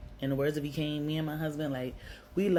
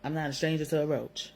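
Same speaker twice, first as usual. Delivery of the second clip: normal talking voice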